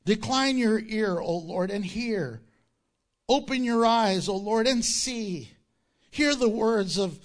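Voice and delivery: loud, rising prayer read to the congregation; scripture reading. Acted. Pastor reading the king's prayer aloud, fervent. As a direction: proclaim the prayer with force.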